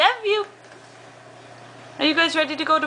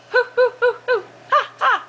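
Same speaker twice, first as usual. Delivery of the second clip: monkey sounds; 9 semitones higher, faster than usual. Shown as a demonstration. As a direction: hoot like a monkey to amuse the child. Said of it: "What does a monkey say?".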